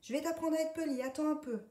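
high, loud, fast threat; angry pony warning; threatening pony. A narrator's reading. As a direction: wag a finger and threaten. Pony threatens the insolent duck.

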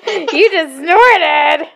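drawn-out teasing. Real, not acted. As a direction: stretch every word out playfully. Teasing child repeats.

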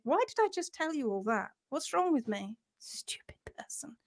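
hushed muttering to herself; very soft, whispered self-reproach; self-scolding whisper. Real, not acted. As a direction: whisper it as a private telling-off.

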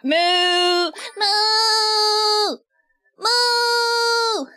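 high, long held mooing; playful cow moo. Pretend. Streamer reading the chat, playful mooing. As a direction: moo in rising, drawn-out calls.

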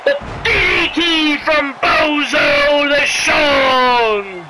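stretched, slow announcer call; drawn-out move call. Real, not acted. Commentator calling a DDT, slow stretched shout. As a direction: draw out the big move's name.

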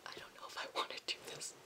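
fast whisper to herself; nervous whisper. Real, not acted. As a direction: breathe it out fast, unsure. Nervous aside before the reveal.

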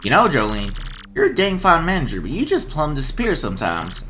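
Southern twang for a cheerful ringmaster; southern accent. Acted. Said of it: "I love this accent I'm giving him".

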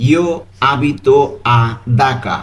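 "Io abito a Dhaka" drawn out word by word; slow model answer. Shown as a demonstration. Teacher announcing the correct Italian answer, very slow. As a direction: give the correct answer slowly, each word clear. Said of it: "সঠিক উত্তরটি হবে".

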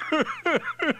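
high rapid cackle; drunken laugh. Acted. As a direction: cackle as the tipsy chief. Drunken chief laughs at himself.

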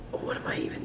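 a whispered aside to herself; a quiet unsure whisper. Real, not acted. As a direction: breathe it out unvoiced, overwhelmed. Overwhelmed, talking to herself.

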